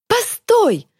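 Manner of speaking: very high, urgent cry; shrieked plea. A narrator's reading. Girl calling after her fleeing friend, shriek. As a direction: squeal it out after someone leaving. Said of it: "Варя взвизгнула".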